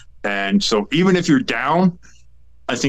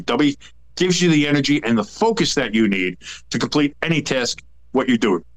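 ordinary talk, nothing marked

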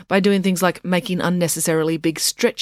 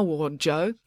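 usual speaking voice

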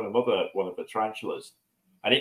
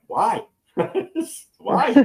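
much higher, louder disbelieving why; baffled why; 12 semitones higher, 6 dB louder than usual. Retold or imitated. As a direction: cry out why in total disbelief at keeping a spider. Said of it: "in my head is like".

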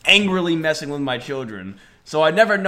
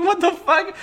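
disbelief. Retold or imitated. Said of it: "Like, I just like".

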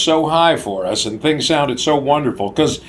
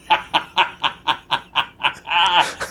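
laughing; 3 semitones higher than usual. Real, not acted. Laughing at his own story.

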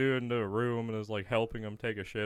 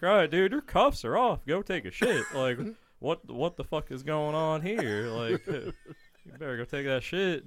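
mildly exasperated, higher retelling; getting mad; 5 semitones higher than usual. Retold or imitated. Guard telling inmate to go, mild retold irritation. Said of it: "like, getting mad".

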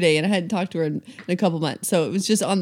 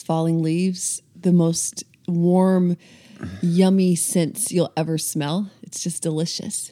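slow, low, lulling delivery; drowsy slow; 3 semitones lower, slower than usual. Real, not acted. Host lulling listeners with autumn scents, drowsy and slow. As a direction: linger sleepily over each cozy scent. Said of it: "I'm literally falling asleep. We gotta pick up the pace".

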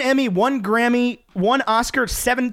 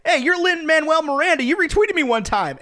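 high-pitched eager fan voice; excitable, raised-pitch hello; acted starstruck greeting; 9 semitones higher than usual. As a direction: gush at a celebrity you idolize. Acting out greeting a celebrity.

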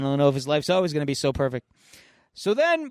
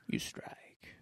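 whispered, breathless mentor line; 18 dB quieter than usual. Retold or imitated. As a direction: breathe it out as a hush. Mentor instructs Iron Fist.

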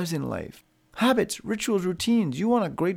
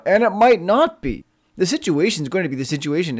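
ordinary talk

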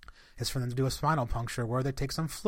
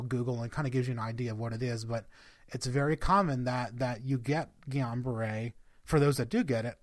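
normal talking voice

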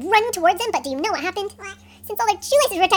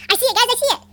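high, urgent cry of discovery; excited lookout; 6 semitones higher, 4 dB louder, faster than usual. A narrator's reading. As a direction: call it out to the crew, thrilled. Lookout spots the enemy.

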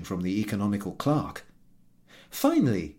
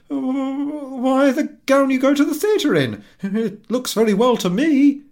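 much higher, louder flustered husband; stammering husband; 9 dB louder than usual. Acted. Husband to wife about her gown, much higher and flustered. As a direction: caught off guard, groping for an answer. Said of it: "He stammered".

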